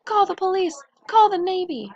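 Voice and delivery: high, loud panicked shouting; panicked yell. A narrator's reading. Coach panicking at the creature, yelling for help. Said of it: "shrieked the coach".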